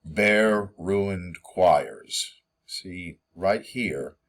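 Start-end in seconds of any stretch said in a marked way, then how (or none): 0.0-2.4 "Bare ruined choirs" very slow, each syllable stressed; three heavy stresses. Shown as a demonstration. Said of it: "it's not an unstressed, stressed".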